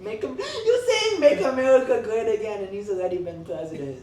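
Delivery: loud, high-pitched incredulous outburst; laughing disbelief. Real, not acted. Host to friend about the slogan, loud laughing disbelief.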